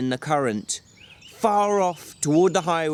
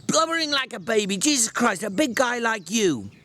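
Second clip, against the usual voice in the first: angry scolding; 8 semitones higher than usual. Acted. George scolds crying Lennie.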